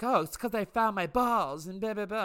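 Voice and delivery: put-on high voice, mocking; mocking imitation. Mocking retell of co-star's excuse.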